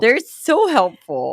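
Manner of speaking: slow, high, loud sing-song; teacher voice. Pretend. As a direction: talk down like to toddlers. Mock teacher echo.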